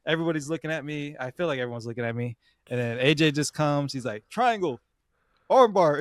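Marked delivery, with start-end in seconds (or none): 4.3-6.0 high-pitched coaching calls; imitated yelling. Coach arriving mid-match to yell instructions, called out high. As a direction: call out the moves, pitched up. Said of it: "He's just yelling shit".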